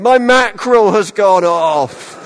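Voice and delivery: slow, high, loud posh lament; hammed-up posh middle-class tearful complaint; posh middle-class wail. Student phoning mother in floods of tears, hammed-up posh wail. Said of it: "very kind of posh middle class way".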